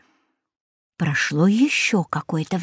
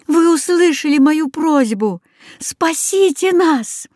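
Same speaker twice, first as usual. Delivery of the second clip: pleading; 7 semitones higher than usual. A narrator's reading. Boy begs goose for rescue.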